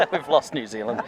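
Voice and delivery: laughing through the line; laughing at himself. Real, not acted. Commentator laughs after his bad accent falls flat. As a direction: chuckle as you admit the flop. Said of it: "That was a poor accent".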